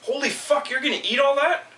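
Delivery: high-pitched mock shocked girl; mocking coworker girl. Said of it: "said it like this".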